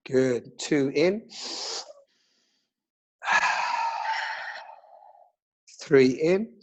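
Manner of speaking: calm counting breath guide; slow soothing breathwork instructor; guided meditation. Acted.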